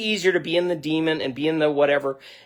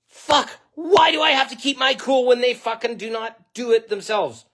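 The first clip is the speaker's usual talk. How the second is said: retold rant, pitched high and raised; exasperated outburst; 10 semitones higher than usual. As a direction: vent at yourself, fed up. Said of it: "I was beside myself".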